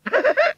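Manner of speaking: high Cockney giggle; little giggle of amusement; girlish giggle. Acted. Scarecrow model giggling at Wurzel, high giggle. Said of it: "she giggled".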